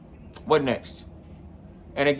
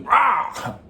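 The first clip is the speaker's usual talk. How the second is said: short pumped-up yell, higher; mock battle cry; 9 semitones higher, 3 dB louder than usual. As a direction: let out a retold burst of nerve. Psyching up before throwing chair.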